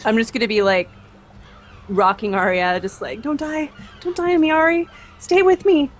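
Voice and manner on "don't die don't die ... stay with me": high, anguished plea; desperate pleading. Acted. Sister rocking the poisoned girl, pleading desperately. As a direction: beg a dying loved one to stay. Sister rocks her dying sister.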